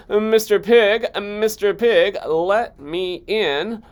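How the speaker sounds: high, loud slow call. Acted. Wolf calling to the pig at the stick house. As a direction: call politely through the door. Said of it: "I called".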